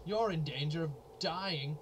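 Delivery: startled by the danger warning; alarmed. Real, not acted. Gamer startled by a danger alert, alarmed.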